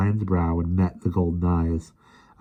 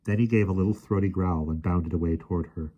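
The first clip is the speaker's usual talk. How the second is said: ordinary delivery, nothing marked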